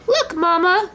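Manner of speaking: excited joey to his mother; little Roo. A narrator's reading. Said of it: "said Roo".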